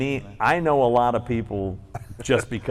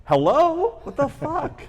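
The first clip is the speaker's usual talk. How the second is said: very high, incredulous squeal of protest; mock-indignant, pitched way up; indignant disbelief; slower than usual. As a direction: act out outraged disbelief, voice shooting up. Retells outrage at being passed over.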